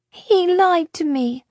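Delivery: put-on a small girl grumbling that she was tricked; complaining little girl. A narrator's reading. Girl to Luca, complaining of the lie. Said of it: "she complained".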